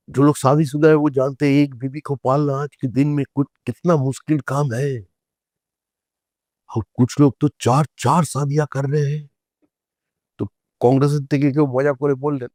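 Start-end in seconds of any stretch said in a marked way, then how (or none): 0.1-9.3 higher-pitched imitation of a parliamentary orator; Atalji's Parliament speech. Leader joking about marriage in Parliament, imitated oratory. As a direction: retell a witty speech with sustained, raised pitch. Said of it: "পার্লামেন্টে অটলজি বললেন যে".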